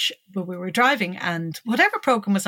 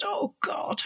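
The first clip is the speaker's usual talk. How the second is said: whispered dismay; 12 semitones higher, 8 dB quieter than usual. Retold or imitated. Dismayed inner thought.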